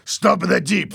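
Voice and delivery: high, loud command; acted angry bark; threatening order. A narrator's reading. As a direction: demand it with force. Louis orders while choking Fernando.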